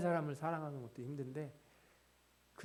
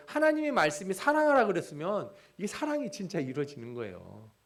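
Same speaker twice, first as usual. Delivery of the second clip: louder preaching voice, pressing rhetorical questions; impassioned preaching; 7 semitones higher, 10 dB louder than usual. Acted. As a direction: preach it out, challenging the congregation. Preaching at full force.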